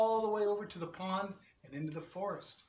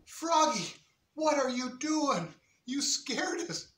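higher, a touch startled; startled kids; 8 semitones higher than usual. Acted. Kids startled by Froggy, higher startled voice. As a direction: gasp it at the frog.

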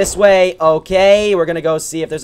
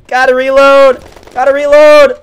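loud, much higher urgent repeat; urgent call; 10 semitones higher, 12 dB louder than usual. Real, not acted. Gamer urgently calling to reload under fire, loud. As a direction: hurry to reload.

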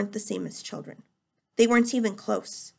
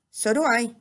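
usual speaking voice

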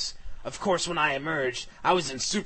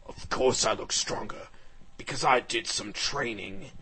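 dragon's voice; 4 semitones lower than usual. Acted. Dragon explaining his new strength to Blade, dragon's voice. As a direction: lower character voice. Dragon character answers in own voice.

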